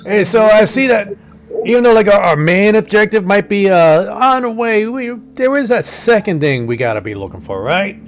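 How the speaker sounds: loud, high New York wiseguy; Brooklyn accent. Acted. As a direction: talk it up like a Brooklyn hustler. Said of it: "This is more of a Brooklyn accent".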